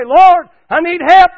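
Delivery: high, slow, very loud plea; crying out for help. Retold or imitated. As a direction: call out to the Lord in trouble. Acts out crying to God in trouble.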